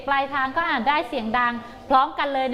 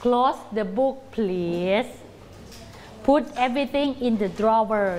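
slow commands; 3 semitones lower, much slower than usual. Shown as a demonstration. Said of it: "teacher giving slow commands".

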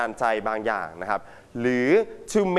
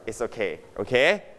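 ordinary talk, nothing marked

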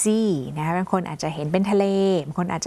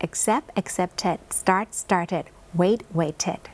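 slow model drill; much slower than usual. Shown as a demonstration. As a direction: accept accepted start started wait waited read very slowly. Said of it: "drill recap". (อ่านช้ามากทีละคำเพื่อให้นักเรียนฟัง)

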